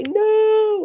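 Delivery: put-on a kid snapping back a refusal; mimicking a child's loud no; child's sharp no. Child refusing mom's offer.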